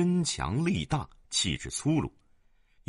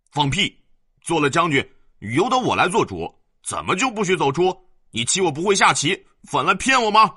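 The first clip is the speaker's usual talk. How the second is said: put-on high, loud furious child's yell; spoiled boy throwing a tantrum, louder and higher to the end; raging boy; 9 semitones higher, 7 dB louder than usual. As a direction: scream at him, building in fury. Boy flies into a rage.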